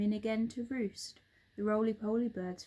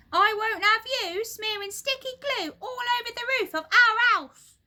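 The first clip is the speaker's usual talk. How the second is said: much higher, loud shrill nag; shrill old wife; 14 semitones higher, 10 dB louder than usual. A narrator's reading. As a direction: screech it like a cranky old wife. Missus Twit objects loudly.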